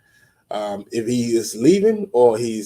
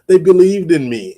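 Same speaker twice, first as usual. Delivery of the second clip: higher, louder mocking sing-song; mocking the grateful player, a bit higher and louder; mock naive player; 6 semitones higher, 5 dB louder than usual. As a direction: imitate a starry-eyed player, hammed up. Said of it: "Don't be like".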